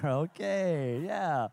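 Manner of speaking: ordinary talk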